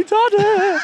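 put-on high, loud child's cry of Daddy; imitating child's shout. Son calling to dad at the theatre, high loud child's shout. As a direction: call out like a small boy stuck too high.

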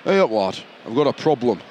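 Yorkshire mobster, lightly hammed up; broad Yorkshire accent. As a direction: play the boss as a Yorkshireman. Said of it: "I'm a Yorkshireman in New York".

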